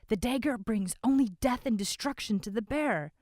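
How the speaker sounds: loud, insistent; protesting. A narrator's reading. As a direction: warn against the dagger. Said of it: "another voice protested".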